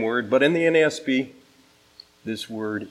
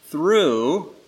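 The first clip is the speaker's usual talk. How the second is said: "through" drawn out, higher in pitch; emphasis on through; 5 semitones higher, 4 dB louder than usual. Shown as a demonstration. Preacher reading Romans so listeners catch the repeated word, stressed "through". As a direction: lean hard on "through" and let it hang. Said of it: "to emphasize a word".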